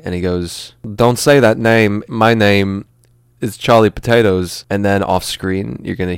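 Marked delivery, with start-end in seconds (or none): 1.0-4.6 slow, emphatic, mock-dramatic insistence; louder, weighty movie-star correction; Christopher Walken. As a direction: lay down the law with odd halting phrasing. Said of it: "after Christopher Walken changes his name".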